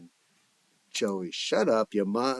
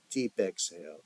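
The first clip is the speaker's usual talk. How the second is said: ordinary delivery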